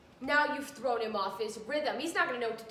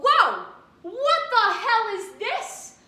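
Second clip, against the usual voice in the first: much higher, louder, slow fake shock; mock surprise; 10 semitones higher, 11 dB louder, much slower than usual. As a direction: ham up the fake discovery. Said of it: "and I go".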